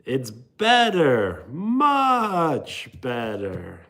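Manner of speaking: drawn out very slowly, savoring each word; quoting a TV sketch. Retold or imitated. To chat, quoting an old Nickelodeon sketch, very slow drawl. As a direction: stretch much better out like a smug ad. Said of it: "It's from an old T V show".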